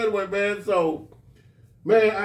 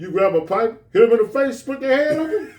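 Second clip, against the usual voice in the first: high, loud, laughing through it; wound-up preacher rant, half laughing; mock rant; 8 semitones higher, 5 dB louder than usual. Old teacher ranting about women, high loud and laughing.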